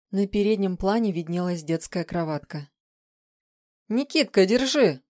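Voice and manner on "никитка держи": high, loud call; shouted order. A narrator's reading. Woman to a helper who ran up, shouting. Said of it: "крикнула тень".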